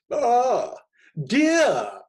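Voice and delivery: high, loud, theatrical "Ah, dear"; sprightly emotional greeting. A narrator's reading. Narrator greeting Delia in a showy, emotional stage voice. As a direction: gush it like a stage actor. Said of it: "with more sprightliness and emotion in my voice".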